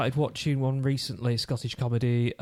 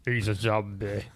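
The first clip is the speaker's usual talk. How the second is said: lower, slow and softer zombie voice; 4 semitones lower, 3 dB quieter, slower than usual. Pretend. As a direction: drag it out like a zombie. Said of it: "That's my zombie voice".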